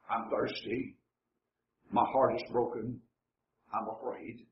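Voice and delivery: low, very soft voice of defeat, sinking to a near-whisper; hushed despair. Acted. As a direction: give up quietly, as if overwhelmed and drowning. Acting out the overwhelmed, despairing psalmist.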